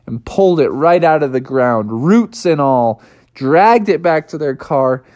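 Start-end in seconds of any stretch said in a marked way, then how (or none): none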